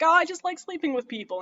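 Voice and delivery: high-pitched, carefree mock shrug; high airy mock voice. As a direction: toss it off sky-high and carefree. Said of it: "and she's like".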